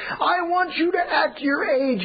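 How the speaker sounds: high, slow yelling dad. Retold or imitated. Father losing patience with his son, slow high shouting. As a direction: yell it slowly at the child. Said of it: "the volume got louder and louder and louder".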